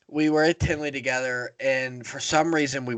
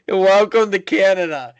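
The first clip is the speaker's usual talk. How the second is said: higher and louder, laughing through the words; 8 semitones higher, 8 dB louder than usual. Real, not acted. Telling a friend about ending up in Canada, laughing. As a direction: burst out laughing as you repeat it.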